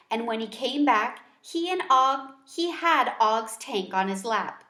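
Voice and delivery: ordinary delivery, nothing marked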